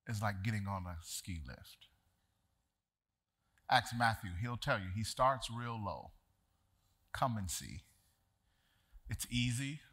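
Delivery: soft, low reflective sermon voice; preacher turning the story into a quiet lesson; quiet preaching. Acted. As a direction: draw them in gently, almost hushed.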